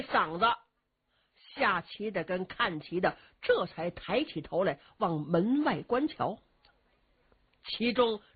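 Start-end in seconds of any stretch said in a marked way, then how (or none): none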